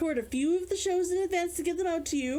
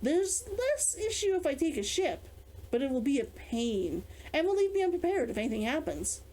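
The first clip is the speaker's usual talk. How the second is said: story narration; 5 semitones lower than usual. A narrator's reading. Reads novel chapter aloud.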